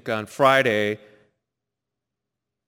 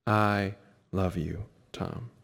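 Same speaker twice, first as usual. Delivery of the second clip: tender; 6 semitones lower, 7 dB quieter, slower than usual. Retold or imitated. Quoting the longed-for words again.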